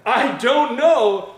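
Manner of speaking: loud, very drawn-out mock protest; emphatic denial. The husband answers his wife's question, in loud acted protest.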